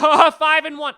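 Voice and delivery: loud, high mock fan gloating. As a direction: crow like an overexcited rival fan, mockingly. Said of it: "Patriots fans will be like".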